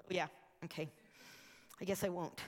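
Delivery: low, soft mock surrender; deflated. Would-be evangelist rebuffed by neighbor, deflated. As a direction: back down meekly, voice dropping.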